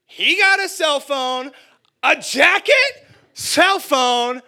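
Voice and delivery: high, loud, drawn-out protest; outraged inner tantrum. Acted. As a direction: wail the unfair comparison, building to a shriek. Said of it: "But inside I'm thinking".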